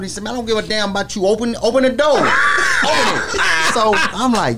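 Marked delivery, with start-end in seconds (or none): 0.2-3.4 mock angry shout. Retelling how [name] yelled at mall security, mock shout. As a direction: bark it like a rapper who expects the door opened.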